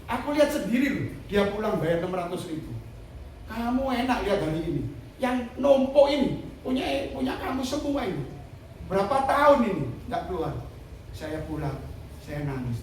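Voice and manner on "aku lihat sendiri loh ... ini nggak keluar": raised, louder retold reproach; acted indignant complaint. Retelling a reproach over the spare-part profits, mock indignation. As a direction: act out an aggrieved telling-off.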